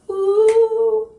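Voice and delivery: ordinary talk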